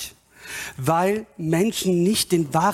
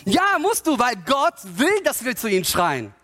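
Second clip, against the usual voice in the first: high, insistent preacher's answer; impassioned, pitched-up insistence; emphatic rebuttal; 5 semitones higher than usual. Real, not acted. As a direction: overrule the objection with fervour. Preacher answers the imagined objector.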